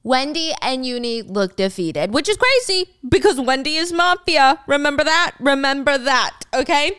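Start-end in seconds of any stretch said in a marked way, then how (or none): 2.1-7.0 excited aside. Real, not acted. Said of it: "excited reminder".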